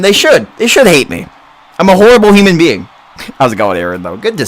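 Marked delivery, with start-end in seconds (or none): 0.0-2.8 rising higher and louder, quickening; hammed-up self-roast; mock self-condemnation. Real, not acted. Streamer jokingly tells chat his friends should hate him, getting louder.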